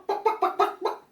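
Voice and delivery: high chicken bawks; chicken clucking. Shown as a demonstration. Man doing funny animal noises, high chicken clucks. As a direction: cluck like a hen. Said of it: "F A N means funny animal noises".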